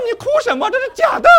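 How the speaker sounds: high, loud teasing scold; mock scolding. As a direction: scoff at her for crying over a drama. Mocking wife crying at TV.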